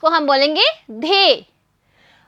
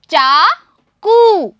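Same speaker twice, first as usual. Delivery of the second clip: चाकू dragged out very slowly and a bit louder; very slow model word; 4 dB louder, much slower than usual. Shown as a demonstration. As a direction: stretch चाकू long for the class. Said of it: "मैं आपको पढ़ के दिखा रही हूँ". (चाकू बहुत धीरे खींचकर)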